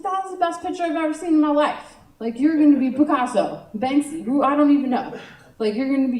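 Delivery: hammed-up auntly enthusiasm; gushing aunt. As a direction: rave to a small child about their drawing. Aunt gushing over nephew's drawing.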